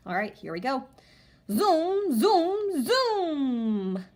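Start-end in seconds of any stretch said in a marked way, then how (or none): 1.5-4.0 high, loud sing-song zooms; fun read-aloud. Shown as a demonstration. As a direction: make it sound so fun. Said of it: "use inflection in our voice and make it sound so fun".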